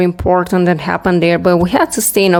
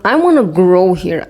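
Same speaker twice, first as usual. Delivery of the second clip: usual speaking voice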